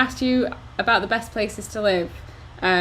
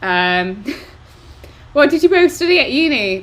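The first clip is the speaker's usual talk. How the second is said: ordinary delivery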